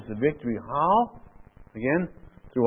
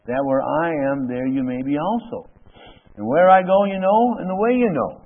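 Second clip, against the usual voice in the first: raised, louder preaching of the verses; scripture read with fervor; 2 semitones higher, 3 dB louder than usual. Acted. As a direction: proclaim the promise to the congregation. Preacher reads Jesus' words aloud.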